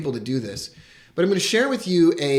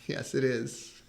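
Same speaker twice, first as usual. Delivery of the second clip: laughing softly; 8 dB quieter, slower than usual. Real, not acted. Unicorn cup question, laughing.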